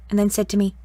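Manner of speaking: ordinary delivery, nothing marked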